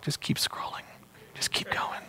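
hushed, lulling whisper; whispering over a crib. Acted. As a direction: whisper it like a tempter soothing. Enemy lulling listeners to stay distracted.

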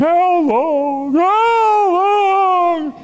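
high, loud crying out to God; anguished cry. Acted. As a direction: cry out how long, rising to a shout. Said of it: "statements of complaining".

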